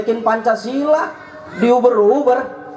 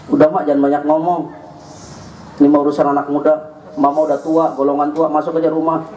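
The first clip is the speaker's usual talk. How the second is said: youngster brushing off mother; 11 semitones lower, faster than usual. Retold or imitated. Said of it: "Bocah sekarang tuh begitu tuh".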